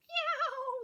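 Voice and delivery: high, loud yowling meow; cat meow. A narrator's reading. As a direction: yowl like an upset cat. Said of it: "said Wilbur".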